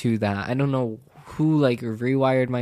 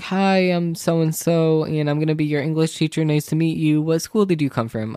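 lightly voiced teacher, higher pitch; a hint of a teacher introducing herself, pitched up; English teacher's greeting; 4 semitones higher, 3 dB louder than usual. English teacher greets a new student, lightly imitated in higher pitch. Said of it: "the teacher was like".

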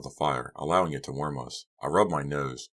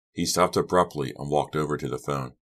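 usual speaking voice

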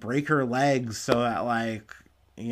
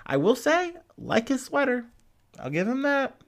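a much higher, sing-song grudging admission; grudging praise; 11 semitones higher than usual. Real, not acted. Vlogger grudgingly praising the sweater, high teasing lilt.

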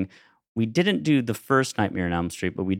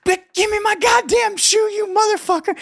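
very high, frantic mock yell; hammed-up high-pitched yell; shoeless guy yelling; 3 dB louder than usual. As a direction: squeal it like a desperate drifter wanting his shoe. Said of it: "And he's going".

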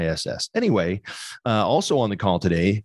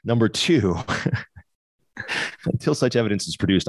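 normal talking voice

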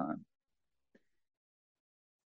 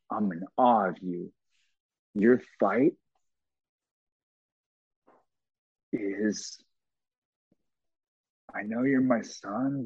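tender father's prayer. Retold or imitated. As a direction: hold back tears as you tell him. Father prays over son in hospital.

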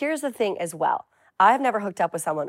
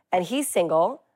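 ordinary talk, nothing marked

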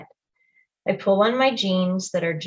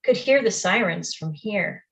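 ordinary delivery

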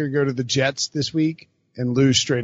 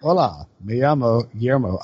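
put-on tourist Spanish accent, lower pitch; 5 semitones lower than usual. Host introducing himself as Guillermo, put-on Spanish accent. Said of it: "the gig I'm going with".

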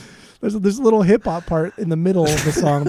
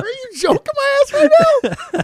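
high, loud disbelieving outburst; incredulous; 5 dB louder than usual. Real, not acted. To his friend about the barking song, high incredulous cry. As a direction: pitch it way up in total disbelief.

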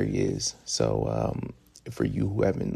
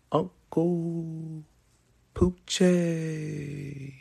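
lightly hyped-up announcer call, higher; hyped host name; 8 semitones higher, 3 dB quieter, much slower than usual. Acted. Host introducing himself to listeners, mild hype call. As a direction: sell the name like a radio host.

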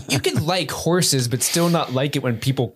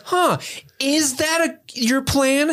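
much higher, incredulous huh; mock suspicion; 13 semitones higher, slower than usual. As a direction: feign dawning suspicion. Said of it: "And I'm like".

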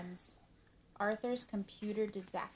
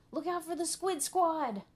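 high, loud warning yell; 9 semitones higher, 8 dB louder than usual. A narrator's reading. Buster warning Arthur during the game, yelling. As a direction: yell out an urgent alert. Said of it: "yelled Buster".

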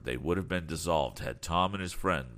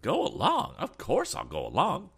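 high, louder burst of eager surprise; excited cry; 12 semitones higher, 5 dB louder than usual. A narrator's reading. Eccentric man accepting the lad's invitation, crying out excitedly. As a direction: jump at the invitation, pitch leaping up. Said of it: "cried the eccentric man".

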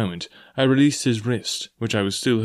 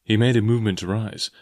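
ordinary delivery

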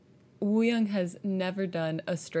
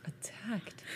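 whispered; 12 dB quieter than usual. Real, not acted. Incredulous echo.

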